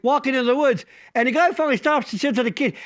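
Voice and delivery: usual speaking voice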